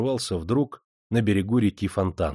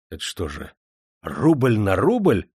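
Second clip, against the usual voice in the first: gasping in shock; slower than usual. Acted. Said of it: "ахнул Демидов".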